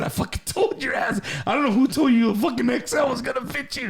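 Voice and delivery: high-pitched told-you-so rant; mock scolding. Host retells telling off his friend about the shirt, mock scolding.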